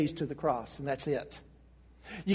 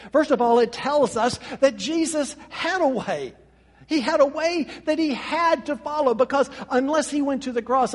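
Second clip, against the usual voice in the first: preaching at full force; 3 semitones higher, 4 dB louder than usual. Acted. Preacher to brothers and sisters, triumphant build. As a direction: build each line up to the coronation. Preacher climbs to resurrection climax.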